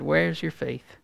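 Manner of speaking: softly, without reproach; gentle. Retold or imitated. Jesus gently asking his frightened disciples, softly. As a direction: ask it gently, like a kind teacher. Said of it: "But I think he gently said".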